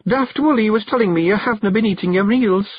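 careful. A narrator's reading. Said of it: "said Jeanie carefully".

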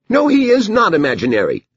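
put-on very high little-girl protest; indignant child. Daughter protests to her mother, indignant child voice.

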